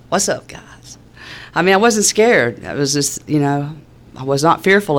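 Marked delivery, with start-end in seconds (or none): 0.1-1.0 breathy whispered greeting; cool, hushed what's up. Retold or imitated. To the agents around her house, a casual hushed greeting.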